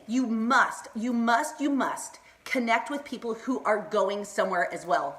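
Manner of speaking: usual speaking voice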